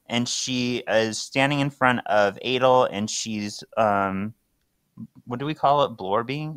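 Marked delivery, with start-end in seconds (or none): none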